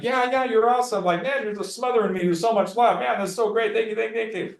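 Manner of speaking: mock client drowning you in thanks; hammed-up delighted client; gushing thanks.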